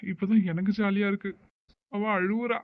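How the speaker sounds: ordinary delivery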